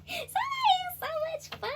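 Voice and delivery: very high delighted cry; real delight. Real, not acted. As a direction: squeal with delight. Laughing delight.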